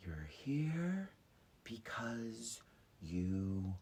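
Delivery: slow, soft fortune-teller hush; mysterious whisper. Retold or imitated. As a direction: whisper it like a mystic. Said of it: "she goes".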